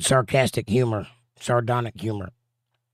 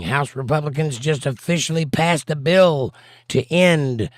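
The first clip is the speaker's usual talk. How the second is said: raised, emphatic breaking-news read; mock newsreader; 3 semitones higher, 4 dB louder than usual. Streamer reading chat news to Lady B, mock anchor delivery. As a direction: announce it like an anchor, pitched up and punchy.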